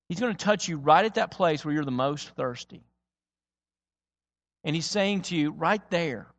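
ordinary talk, nothing marked

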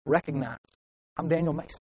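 soft, private reminder of status; hushed self-affirmation; quiet self-importance. Acted. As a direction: say it under your voice, to yourself. Voices private prideful thought.

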